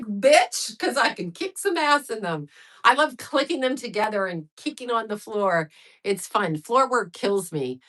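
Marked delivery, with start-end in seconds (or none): 0.0-2.5 sassy, cocky retort, slightly higher; sassy comeback. Retold or imitated. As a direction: toss it back with attitude. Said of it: "I'm like".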